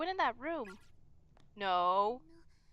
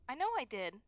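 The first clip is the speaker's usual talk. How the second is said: usual speaking voice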